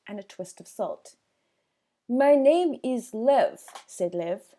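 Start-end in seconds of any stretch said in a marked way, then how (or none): 2.1-3.6 Lev's voice. A narrator's reading. Lev introduces himself.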